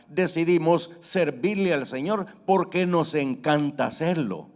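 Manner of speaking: fervent preaching. Acted. Preacher proclaiming to the congregation that Christ freed them, fervent.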